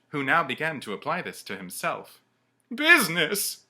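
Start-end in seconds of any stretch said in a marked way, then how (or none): 2.7-3.7 high, loud outraged question; anguished cry. Acted. The ghost cries out at Scrooge, high and loud. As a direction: hurl the word back. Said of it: "cried the ghost".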